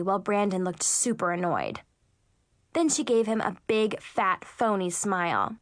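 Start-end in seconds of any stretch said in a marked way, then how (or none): none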